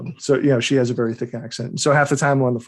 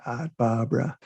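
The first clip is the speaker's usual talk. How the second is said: Boston accent; 3 semitones lower, 4 dB quieter than usual. Shown as a demonstration. Author demonstrating his character's absurd name, Boston accent. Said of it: "so absurd in a Boston accent".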